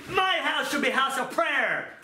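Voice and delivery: Jesus angrily rebuking; anger. Acted. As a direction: declare it in acted anger. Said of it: "That was anger, okay?".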